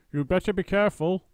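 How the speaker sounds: put-on old wife's shrill-pitched threat; Missus Twit warning. A narrator's reading. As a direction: wag a finger at him with your voice. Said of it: "Missus Twit said".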